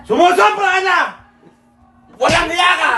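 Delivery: high, loud acted outburst; hammed-up angry shout. Shown as a demonstration. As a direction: yell it high in mock fury, pausing midway. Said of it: "Hindi, galit".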